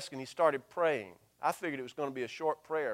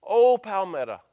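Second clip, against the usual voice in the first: louder, higher anguished call to the town; pleading lament with a raised voice; lamenting cry; 4 semitones higher, 8 dB louder than usual. Retold or imitated. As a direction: cry the name out in grief. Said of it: "in a strong whisper".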